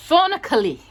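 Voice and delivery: ordinary delivery, nothing marked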